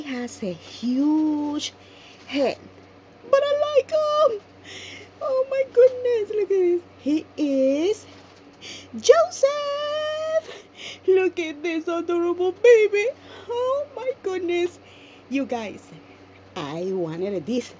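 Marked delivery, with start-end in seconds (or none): 3.3-14.8 high, loud delighted squealing; excited squeal. Real, not acted. Revealing the doll Joseph.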